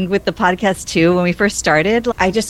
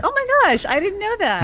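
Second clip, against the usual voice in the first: squealing, much higher surprise; surprised; 3 dB quieter than usual. Retold or imitated. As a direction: gasp it out up high in shock. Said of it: "I was like".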